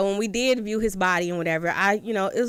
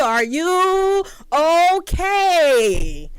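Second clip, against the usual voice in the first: mouthed through the window; 8 semitones higher, 4 dB louder, much slower than usual. Retold or imitated. He doing all this on my window.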